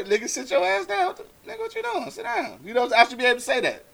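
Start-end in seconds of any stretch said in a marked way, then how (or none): 0.0-2.6 mock scolding. Stepdad telling a mate's kid to sit, high mock scolding. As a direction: scold a kid in a raised pitch.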